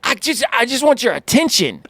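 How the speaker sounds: high-pitched, protesting self-defence; mock defensive. Parent insists it is not yelling, high defensive protest.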